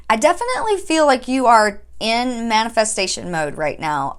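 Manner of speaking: normal talking voice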